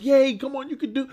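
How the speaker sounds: high, bright mock cheer from the stands; playful imitation of rooting for the hero. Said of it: "I wasn't like".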